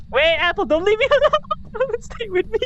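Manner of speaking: high frightened plea; scared pleading. Real, not acted. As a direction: beg them not to leave. Scared, begging not to be left.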